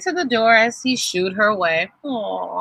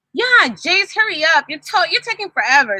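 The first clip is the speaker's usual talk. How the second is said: put-on higher, impatient kid voice; a hint of an impatient boy; Tony's voice; 9 semitones higher than usual. A narrator's reading. Tony voiced in read story.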